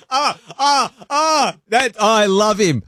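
high, laughing delight. Real, not acted. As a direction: gush through the laughter. Host delighted by the slang.

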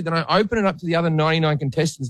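ordinary talk, nothing marked

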